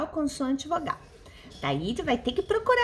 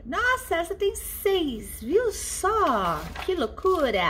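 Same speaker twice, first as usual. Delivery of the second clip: high-pitched, playful "wow" reaction; playful amazement; 4 semitones higher than usual. Pretend. Teacher amazed for the class at six syllables, playful amazement. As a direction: marvel in mock wonder, ending on a chuckle.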